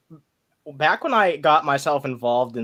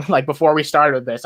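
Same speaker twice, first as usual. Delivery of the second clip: ordinary delivery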